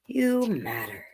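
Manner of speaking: gentle reassuring echo; soft echo. Acted. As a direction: say it tenderly to them.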